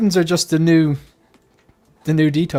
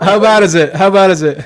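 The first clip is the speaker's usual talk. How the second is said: higher, louder put-on American voice; American accent; 4 semitones higher, 8 dB louder than usual. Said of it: "American accent".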